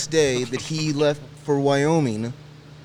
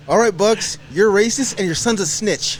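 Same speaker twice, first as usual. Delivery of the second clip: loud, raised-pitch retold call-out; mock accusation; 5 semitones higher, 4 dB louder than usual. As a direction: announce the verdict loudly for laughs. Retold comic verdict on his friend.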